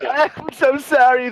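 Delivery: high-pitched, hammed-up apology; mock apology. Host apologizing to the statue forum, mock contrite.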